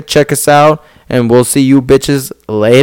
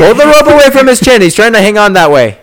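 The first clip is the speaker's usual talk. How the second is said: high, loud shout at the ref; 8 semitones higher, 3 dB louder than usual. Acted. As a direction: yell it like a ringside fan. Repeating the call to the ref.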